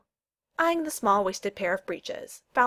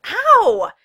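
high, loud yelp of "ow"; pained yelp; 14 dB louder than usual. A narrator's reading. Friend hit by a thrown boot, yelping. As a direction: yelp as if struck. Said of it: "yelped as the hurled boot found".

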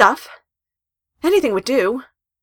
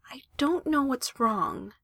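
slow; 5 semitones higher, 7 dB quieter, slower than usual. Acted. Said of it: "Mizuki said slowly".

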